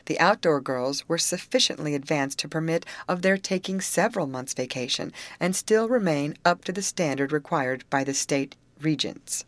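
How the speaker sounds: ordinary delivery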